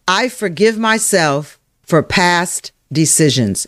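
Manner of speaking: slow, spaced phrases, paced for repeating; slow affirmation. Shown as a demonstration. Coach modelling an affirmation for listeners to type, slow and deliberate. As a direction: say it slowly and deliberately.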